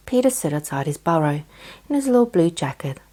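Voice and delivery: ordinary delivery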